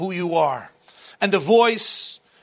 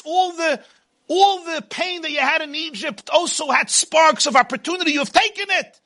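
booming motivational speech; 7 semitones higher than usual. Acted. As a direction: call out the thief. People accusing the thief.

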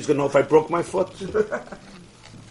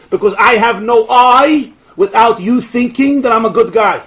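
impassioned preaching; 6 semitones higher, 8 dB louder than usual. Acted. Preaching the point with force.

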